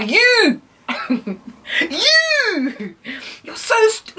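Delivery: very high squeal on "you"; hammed-up high-pitched indignation; outraged disbelief. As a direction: squeal it out, scandalised. Outraged at his suggestion.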